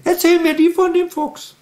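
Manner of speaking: very high whining puppet voice, near tears; a squeaky little creature protesting bedtime; Elfriede's squeaky voice. Acted. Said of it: "Das ist bei Elfriede genauso".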